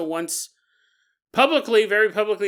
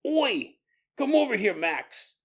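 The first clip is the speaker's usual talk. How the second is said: a light put-on British teen accent, calling over; a hint of cockney lads beckoning; 3 semitones higher than usual. Teenagers in England calling Max over, British accent. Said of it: "they're like".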